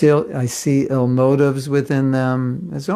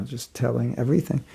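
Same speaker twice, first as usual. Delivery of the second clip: normal talking voice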